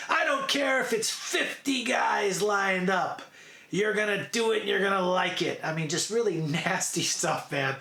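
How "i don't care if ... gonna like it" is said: hammed-up domineering order, easing towards the end; bossy madam. Madam ordering the girls around, imitated harsh command. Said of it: "madam is there, and she's like".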